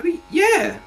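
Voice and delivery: sudden high-pitched yeah; voice jumping an octave. Real, not acted. Friend agreeing the game looks amazing, voice shooting up an octave. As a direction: let it leap up high by accident. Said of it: "my voice just went up an octave".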